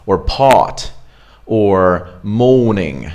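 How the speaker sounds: British English with the R dropped; non-rhotic British demo; British accent. Shown as a demonstration. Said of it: "So in British accent".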